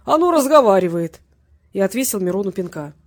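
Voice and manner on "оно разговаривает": high, loud mock amazement; exclaiming. A narrator's reading. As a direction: marvel loudly that it talks. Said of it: "воскликнул Петренко". (громко, с насмешливым изумлением)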